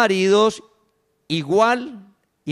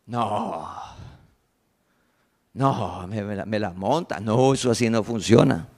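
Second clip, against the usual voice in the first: much lower, muttered mock grumble of a stubborn spouse; grumbling mockery; 11 semitones lower, 5 dB quieter than usual. Preacher mocks a spouse refusing the teaching, low grumble.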